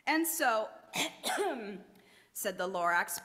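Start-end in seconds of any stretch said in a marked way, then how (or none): 0.7-1.8 a rough throat clear before speaking. A narrator's reading. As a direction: clear your throat, apologetic. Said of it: "Please pardon my cough".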